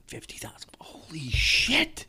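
stunned whisper, slow on holy shit. Real, not acted. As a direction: breathe it out in disbelief. Stunned by car mileage.

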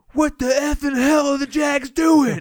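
imitating a bar fan's high, loud, drawn-out outrage; mock furious sports-fan holler; outraged fan shout. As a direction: yell it at the TV, stretching the words. Acting out cussing bar fan.

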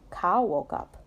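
usual speaking voice

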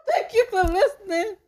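high, loud giggled thank you; cracking up mid line; laughing thank you. Pretend. Signing off to listeners, breaking into laughter. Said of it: "You sound like that lady on Home Shopping Network".

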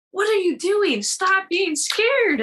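high-pitched, drawn-out mock scolding; imitated coach's scolding. Coach scolding her during the match.

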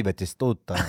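בטיסטוטה in a low, quiet Arabic accent. Shown as a demonstration. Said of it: "אתה יכול גם במבטא ערבי".

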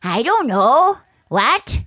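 high, loud playing dumb; mocking the clueless basketball player; mock dumb jock.

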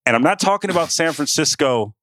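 ordinary talk

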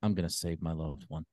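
deadpan. Retold or imitated. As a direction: say it totally deadpan. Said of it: "like deadpan".

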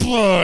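high, loud mock "blah"; dull splat noise. Player voices a face hitting the window, loud mock blah. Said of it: "He just goes".

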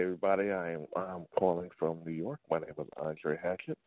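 usual speaking voice

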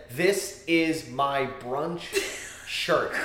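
mocking slow read. Mocking the brunch shirt to friends, slow sneering read. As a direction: drag out the slogan in a low, slow sneer.

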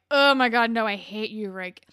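exasperated yell. Retold or imitated. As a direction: shout your disgust at him. Viewer shouting at Rick.

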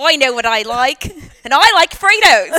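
jingle spoken in broad mock Australian; Australian accent. Shown as a demonstration. Co-host doing the Fritos commercial on request, Australian accent. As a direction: give the ad line an Australian twang, laughter creeping in. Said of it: "do it in an Australian accent".

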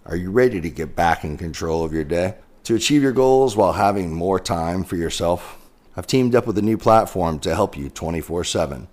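loud, upbeat ad read. Acted. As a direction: pitch it like a sponsor spot. Sponsor ad read for Centered.

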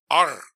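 R rolled once, loud and crisp; one sharp tongue roll on R. Shown as a demonstration. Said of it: "one roll of the R".

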